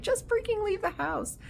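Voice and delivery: high-pitched exasperated mock yell. Viewer yelling at horror movie characters, exasperated mock yell. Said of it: "you're sitting there yelling at 'em".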